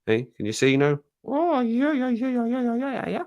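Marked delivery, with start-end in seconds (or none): 1.2-3.2 squeaky made-up cat voice, much higher. Pretend. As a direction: give the cat a tiny high voice. Voicing the cat on camera.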